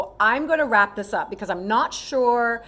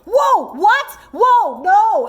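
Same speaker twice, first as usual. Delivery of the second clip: much higher, louder alarmed protest; shocked disbelief; 11 semitones higher, 8 dB louder, much slower than usual. Retold or imitated. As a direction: recoil in loud disbelief. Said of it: "I'm like".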